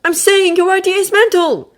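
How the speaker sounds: put-on high, loud haughty boy voice; Draco voice. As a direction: promise it with a sneer.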